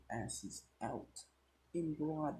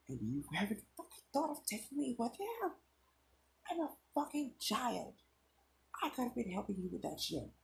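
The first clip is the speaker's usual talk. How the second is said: furious, much higher yell, fast; angry yell; 12 semitones higher, faster than usual. Acted. Boy yells at the detective over the phone, furious and high. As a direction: yell it at him, a kid left behind. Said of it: "Deku yelled at him".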